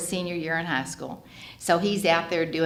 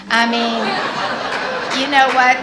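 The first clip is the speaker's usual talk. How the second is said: laughing; 6 semitones higher, 9 dB louder, much slower than usual. Real, not acted. Laughing with glee.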